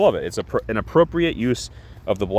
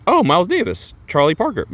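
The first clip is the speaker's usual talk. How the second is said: higher, eager name-dropping; eager listing; 10 semitones higher than usual. Retold or imitated. Peacemaker answering the cashier, eagerly listing jazz greats. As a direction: rattle off names proudly.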